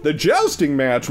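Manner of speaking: usual speaking voice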